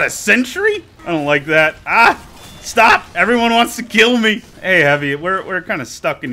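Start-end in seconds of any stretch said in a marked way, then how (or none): 1.9-4.4 panicked shout. Real, not acted. Swarmed by enemies, shouting.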